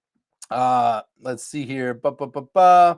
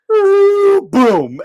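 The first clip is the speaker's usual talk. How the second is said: mock boom; 6 dB louder than usual. Arm slammed down.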